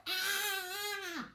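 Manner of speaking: plain reading voice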